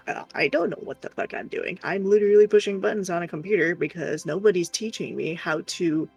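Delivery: usual speaking voice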